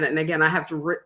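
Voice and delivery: ordinary delivery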